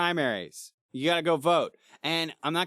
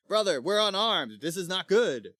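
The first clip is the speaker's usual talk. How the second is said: louder, higher mock-knightly alarm; urgent warning; 6 semitones higher, 6 dB louder than usual. Sir Kay bursts in on his brother Arthur, urgent warning.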